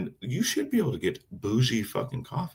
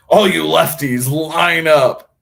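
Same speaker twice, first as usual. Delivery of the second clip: calling out; 6 semitones higher, 9 dB louder, slower than usual. Real, not acted. Calling out to lefty buyers.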